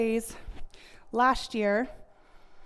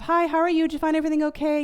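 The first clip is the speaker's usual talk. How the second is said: higher, louder checkout-counter script; cashier greeting; 4 semitones higher, 4 dB louder, faster than usual. Retold or imitated. Cashier greeting customer without looking up, louder and higher. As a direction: rattle it off like a cashier on autopilot.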